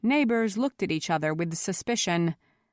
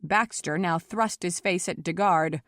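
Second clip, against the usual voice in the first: ordinary delivery, nothing marked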